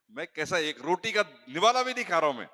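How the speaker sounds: ordinary talk, nothing marked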